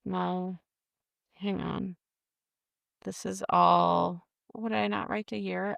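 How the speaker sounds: ordinary talk, nothing marked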